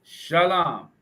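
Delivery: shalom as "shah-LAHM", both vowels open; correct pronunciation. Shown as a demonstration. Repeating the right Hebrew for viewers, shalom pronounced "shah-LAHM". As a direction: say shalom slowly, ending in "lahm". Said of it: "let's get it right".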